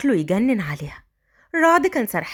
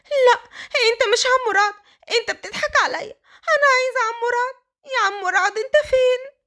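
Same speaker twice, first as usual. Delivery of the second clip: put-on high wailing sobs of a girl; high tearful wailing child; crying child; 10 semitones higher than usual. A narrator's reading. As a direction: cry for her uncle, breaking down. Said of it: "فضلت تعيط".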